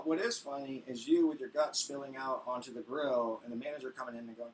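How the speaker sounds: normal talking voice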